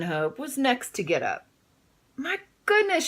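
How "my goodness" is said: very high startled cry; cried out. Acted. Adeline drops her toothbrush, crying out high. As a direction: squeal it in shock. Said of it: "she cried".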